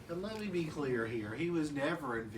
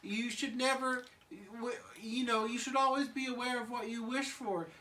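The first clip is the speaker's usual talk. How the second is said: put-on much higher timid baby warning; worried Chucky; 10 semitones higher than usual. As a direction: squeak a nervous warning. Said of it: "Chucky's like".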